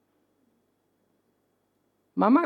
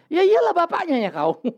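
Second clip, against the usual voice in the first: high, louder cheeky retort; a child answering back to his father; 10 semitones higher, 4 dB louder than usual. Retold or imitated. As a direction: talk back like a sassy kid.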